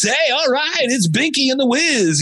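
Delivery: high, hyped-up radio jock patter; mock a morning zoo DJ. Host mocking old radio for podcast listeners, hyped DJ voice. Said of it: "talking like this".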